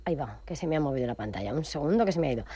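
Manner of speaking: hurried low mutter; quiet aside. Real, not acted. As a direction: drop the voice for a quick aside. Aside about screen moving.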